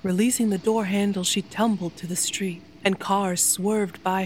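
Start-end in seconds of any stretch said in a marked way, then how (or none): none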